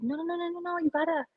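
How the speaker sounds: mildly hammed-up plea to let him finish; mock pleading. Telling the panel to let him speak, mock plea. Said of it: "And I'm just like".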